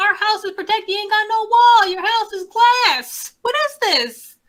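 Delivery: high-pitched, incredulous mock outrage; incredulous protest. Co-host ridiculing the dad's claim of safety, incredulous protest.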